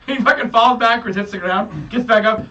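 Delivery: normal talking voice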